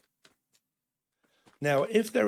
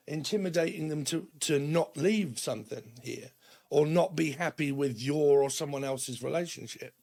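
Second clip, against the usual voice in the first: usual speaking voice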